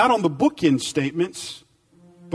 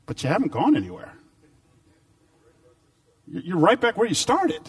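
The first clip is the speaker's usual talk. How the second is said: ordinary talk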